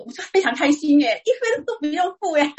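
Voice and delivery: overjoyed at getting off free; delighted. Real, not acted. Joy at paying nothing.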